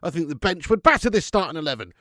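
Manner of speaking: ordinary talk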